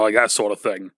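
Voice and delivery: ordinary delivery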